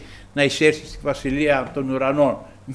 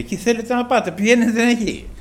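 higher-pitched dismissive order; quoted command in a raised pitch; retold command; 6 semitones higher than usual. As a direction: send them off, voice lifted high. Jesus sending demons away, retold.

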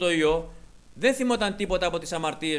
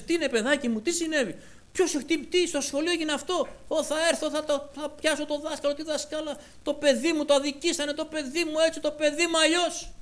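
put-on much higher, fretting over a hurt child; fussing parent; 11 semitones higher, 3 dB louder than usual. Preacher mimics a worried parent fussing over a child. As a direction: gush like an overprotective mum.

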